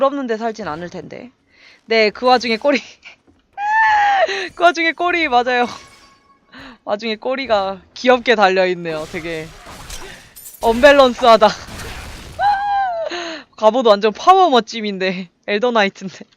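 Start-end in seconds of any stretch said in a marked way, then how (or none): 3.6-4.5 high shrieking laugh; burst of laughter. Real, not acted. Laughing at cute tail. 11.5-13.5 long high giggle; high laugh. Real, not acted. Laughing at unbalanced look.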